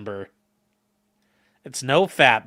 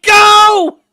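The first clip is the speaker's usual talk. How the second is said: loud, high drawn-out yell; acted-out shout at a horse; retold rider's command; 14 dB louder than usual. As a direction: yell it as a desperate mock command. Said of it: "she's like".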